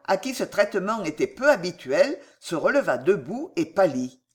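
usual speaking voice

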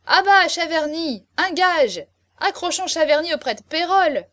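high, very loud heckles; jeering shouts. A narrator's reading. Guests threaten to hang the marquis up, jeering. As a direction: bellow over the speaker. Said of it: "une tempête de huées".

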